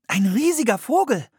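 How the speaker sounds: high, loud and amazed; excited. A narrator's reading. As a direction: she can't believe her eyes. Anne zeigt auf den Vogel. (aufgeregt und staunend)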